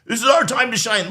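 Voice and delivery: mock the eager viewers with a gleeful shout; mock eager supporter. Host imitating his own excited viewers, mock gleeful shout. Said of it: "a lot of you guys are probably like".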